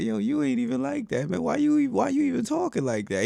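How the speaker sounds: very high-pitched mock disbelief; squeaky-high retold protest; imitating a challenger. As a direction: imitate a friend calling his bluff. Acted bystander challenging the barber.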